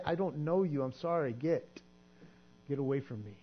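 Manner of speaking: quiet regretful voice of Jesus; sad dismissal. Acted. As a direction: let it fade to a quiet, sorry send-off. Jesus turning the person away.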